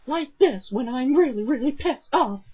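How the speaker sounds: high-pitched mock-sinister woman; sinister Bella Hudson. Mocking the dub actress playing Akiza, sinister. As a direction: put on a high, menacing female voice. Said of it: "she tries to sound sinister".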